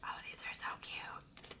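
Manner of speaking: hushed whisper over cute stickers; soft delighted whisper. Real, not acted. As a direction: breathe it out in a whisper. Whispering over happy mail.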